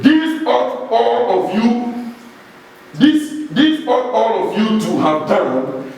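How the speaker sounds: deliberate preacher's emphasis on each word; emphatic. Acted. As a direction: stretch THIS out high and lean on it. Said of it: "Jesus was very emphatic there".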